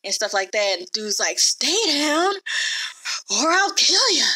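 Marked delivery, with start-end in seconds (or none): 1.4-4.2 higher-pitched, winded mock threat; mock out of breath. Dude tiring as he knocks Theon down, breathlessly threatening. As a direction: act out a tiring fighter straining to threaten. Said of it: "starting to get out of breath".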